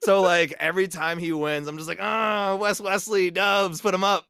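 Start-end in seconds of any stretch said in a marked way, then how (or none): 2.0-4.2 high, loud celebratory call; mock victory cheer. Acting out celebrating his wrestler's win, high and hyped. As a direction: hype up your own game character.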